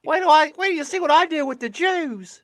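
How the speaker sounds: Monty Python lady. Retold or imitated. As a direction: screech it like a Python housewife. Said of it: "made her like every female Monty Python character".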